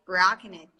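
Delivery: ordinary delivery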